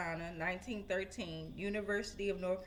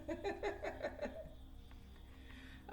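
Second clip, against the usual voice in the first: high, breathy chuckle; laughing; 11 semitones higher, 8 dB quieter than usual. Real, not acted. After talk of extra love, high chuckling. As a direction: let out a long high chuckle.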